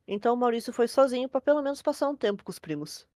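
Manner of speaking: ordinary talk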